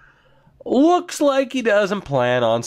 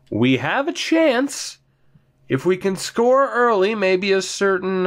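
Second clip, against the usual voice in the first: hopeful; 6 semitones higher than usual. Real, not acted. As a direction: lift the line with sudden hope. Hopeful moment late in hockey game.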